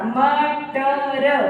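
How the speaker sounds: मटर very slowly, each letter held; sounded out. Shown as a demonstration. Teacher demonstrating pronunciation of मटर, slowly sounded out. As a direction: stretch म, ट, र one by one. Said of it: "एक एक शब्द से उच्चारण करके पढ़ना".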